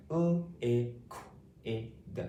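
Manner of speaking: L, I, Q, I, D sound by sound; phonics-style L, I, Q, I, D. Shown as a demonstration. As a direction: segment it with short gaps between sounds. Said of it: "saying these sounds and words".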